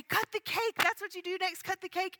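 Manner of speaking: high, eager prodding; imitated urging crowd. Party crowd urges crying Agnes on, excited and insistent.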